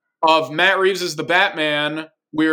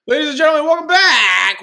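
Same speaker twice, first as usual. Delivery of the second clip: announcer voice; 12 semitones higher, 6 dB louder than usual. Acted. Host opening the show.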